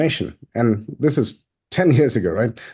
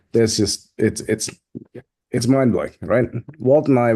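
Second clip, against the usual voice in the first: ordinary delivery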